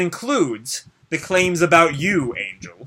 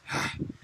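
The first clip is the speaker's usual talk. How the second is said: a soft tired breath out; weary sigh; 14 dB quieter than usual. Acted. Ryuji sighs after the paladin walks off, a soft weary sigh. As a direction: let out a quiet, fed-up sigh. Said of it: "and Ryuji sighs".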